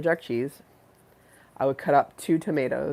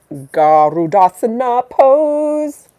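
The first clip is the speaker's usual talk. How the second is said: drawn-out announcement; 8 semitones higher, 11 dB louder, much slower than usual. Acted. Announcing yoga pose loudly.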